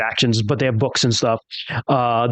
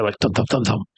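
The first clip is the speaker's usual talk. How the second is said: footstep thumps; 3 semitones lower than usual. Retold or imitated. Imitating footsteps on stairs.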